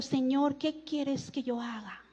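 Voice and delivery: hushed, low, timid question; trembling, fearful. A narrator's reading. Fearful man asking the Lord what to do, hushed. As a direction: let the plea sink to a soft, humbled close. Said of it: "Él temblando y temeroso dijo".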